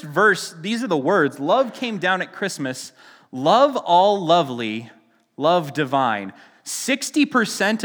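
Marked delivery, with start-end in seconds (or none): 1.4-6.3 "love" drawn out and weighted each time; stress on love. Shown as a demonstration. Pastor reading the lyric to the congregation, weighting each "love". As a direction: lean heavy on every "love", slow. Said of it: "Heavy, heavy on the love".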